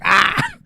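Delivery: high mock squawk; sudden open-mouthed bird cry; bird screech. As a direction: squawk like a cartoon logo bird. Imitates the bird on the logo.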